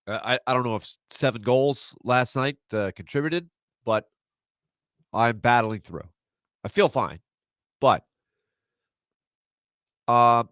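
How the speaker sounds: stuffy nasal voice; talking through a blocked nose. Real, not acted. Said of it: "apologize for my nasally voice today".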